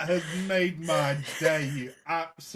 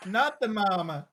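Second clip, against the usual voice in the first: put-on louder, higher baby dinosaur yell; baby dinosaur catchphrase; 5 semitones higher, 5 dB louder than usual. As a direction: shout it like the sitcom baby. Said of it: "the program Dinosaurs".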